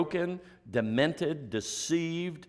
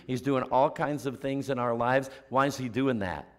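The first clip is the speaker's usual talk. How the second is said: ordinary talk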